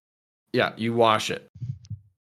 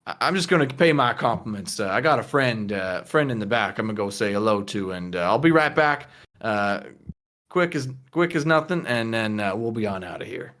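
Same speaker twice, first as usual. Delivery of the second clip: lowered, folksy casual voice; easygoing low-register rambling; Lucas; 5 semitones lower than usual. Acted. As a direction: excuse yourself with halting ease. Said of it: "she sort of looks over to Lucas and he goes".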